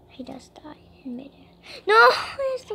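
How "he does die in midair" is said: fast hushed whisper; quick secret whisper. Real, not acted. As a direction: breathe it out quickly, unvoiced. Whispered aside.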